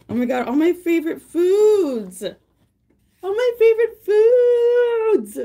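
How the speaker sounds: high, loud, drawn-out delighted cry; high loud gleeful wail; excited squeal. Real, not acted. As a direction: cry out with joy at favorite foods. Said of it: "I literally got so excited".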